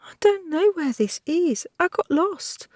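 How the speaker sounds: put-on sobbing girl. A narrator's reading. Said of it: "she sobbed".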